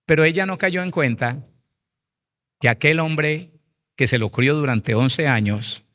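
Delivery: usual speaking voice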